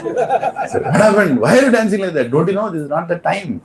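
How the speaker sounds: high-pitched mock scolding, no real volume; retold wifely shout. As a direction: imitate a wife calling her husband to account. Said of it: "my wife shouts".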